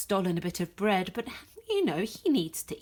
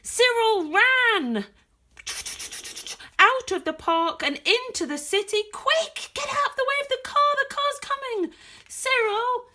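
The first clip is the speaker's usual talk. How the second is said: much higher and louder, urgent; urgent chase; 12 semitones higher, 6 dB louder than usual. Acted. Reader shouting warnings to Cyril in the chase, urgent and high. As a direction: cry out as if the car is about to hit him. Dramatic chase read aloud.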